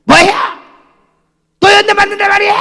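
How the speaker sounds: high, loud outraged shriek; angry woman. Retold or imitated. Woman yelling at the pastor over his prophecy, shrieking. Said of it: "이렇게 했더니".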